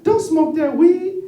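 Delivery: high, slow cartoon rabbit pleading; squeaky little rabbit coaxing. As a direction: chipper high-pitched bunny. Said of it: "he said".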